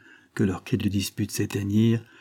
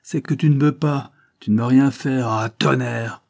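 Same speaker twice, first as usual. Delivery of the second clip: quarrelsome, raised and pushed; angry outburst; 4 semitones higher, 6 dB louder than usual. Acted. Banquiste quarrelling over the child, angry. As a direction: grumble loudly in irritation.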